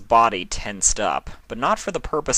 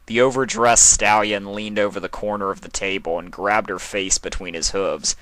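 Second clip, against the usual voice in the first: ordinary delivery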